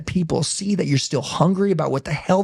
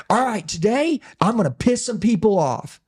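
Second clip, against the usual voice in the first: a hint of a fired-up preacher; 6 semitones higher than usual. Preacher opening a service, lightly voiced and fiery. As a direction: announce it from the pulpit, higher. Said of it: "Preacher talk like this".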